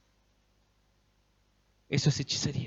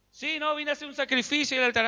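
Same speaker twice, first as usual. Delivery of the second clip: higher, lightly voiced casual prophet; prophet answering; 7 semitones higher than usual. Elijah reassuring anxious townsfolk, higher and offhand. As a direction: brush off their worry breezily.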